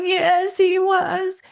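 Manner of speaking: put-on small girl answering the dad, high and tearful; little girl. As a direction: pipe up like a hurt five-year-old. Little girl voiced in story.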